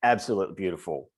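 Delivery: normal talking voice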